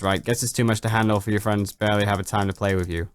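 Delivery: ordinary delivery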